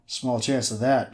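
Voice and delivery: usual speaking voice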